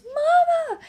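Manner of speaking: put-on high, loud toddler cry of Mama; toddler yelling. Mom imitating her toddler calling out, high and loud. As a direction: shout it like a startled little kid. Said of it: "my toddler goes".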